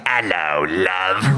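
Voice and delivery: low, mock Cockney greeting. Comic imitates a singer's speaking voice, Cockney accent. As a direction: put on a broad, low Cockney voice. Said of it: "that kind of British that it's like".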